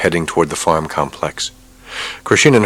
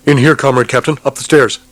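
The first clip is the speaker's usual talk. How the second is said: higher, louder urgent soldier; 8 semitones higher, 4 dB louder than usual. Acted. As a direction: hurry him inside. Soldier urging captain inside.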